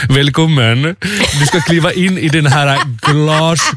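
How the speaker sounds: slow imitated German-accented Swedish. German nurse ushering a patient in, slow German accent. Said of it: "hade en tysk accent".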